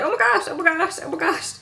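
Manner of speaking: high, repeated cry of alarm; light retold panic, fading at the end; mock panic. Acting Ed startled by monkey.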